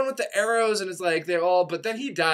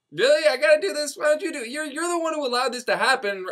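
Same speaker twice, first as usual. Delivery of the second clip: whiny Raphael; 8 semitones higher, faster than usual. Retold or imitated. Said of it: "And Raphael's like".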